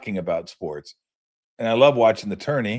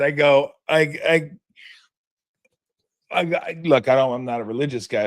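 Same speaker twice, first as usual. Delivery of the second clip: ordinary talk, nothing marked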